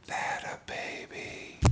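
slow, breathy imitated whisper. Manager whispering praise into the catcher's ear, slow whisper. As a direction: lean in and whisper it slowly. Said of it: "Like that".

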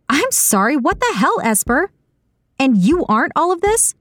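high, swooping affronted questions; incredulous woman's character voice, high-pitched; indignant disbelief. A narrator's reading. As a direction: throw the accusation straight back, pitch soaring. Saga bristles at being called selfish.

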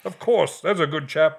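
high, hearty British toff voice; posh British gent. Retold or imitated. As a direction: play a jolly old chap. Jolly Brit voiced.

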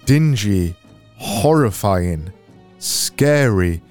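usual speaking voice

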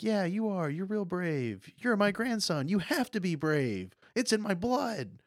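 high-pitched, hammed-up proud grandpa; OG Goku. Grandpa telling his grandson he is brave, proud. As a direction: encourage with high, swooping mock earnestness.

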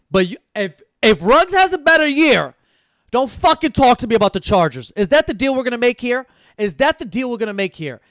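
yelling a dare at a friend over the Chargers; heated challenge. Real, not acted. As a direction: shout the bet at your buddy like you mean it. Challenging friend Steven about Chargers.

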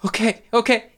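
high, trembling okay; barely holding it together; shaky agreement. Acted. Crying girl agreeing shakily.

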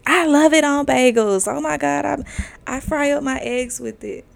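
high, gushing imitation of an enthusiast; mock gushing. Acting out friend gushing over salmon.

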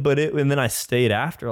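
ordinary delivery

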